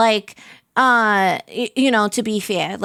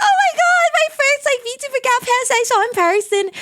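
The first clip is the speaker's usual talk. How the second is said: high, squealing fangirl gush; excited gushing; 13 semitones higher than usual. Retold or imitated. Retelling meeting VTuber friends, high excited squeal. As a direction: gush like you just met your idol in person. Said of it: "where I was like".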